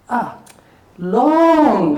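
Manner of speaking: high, loud, hugely stretched "long"; drawn-out retold answer. Trader retelling a veteran's answer on time to profit, drawn out. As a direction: imitate a veteran dragging the word out for ages. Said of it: "He looked at him and said".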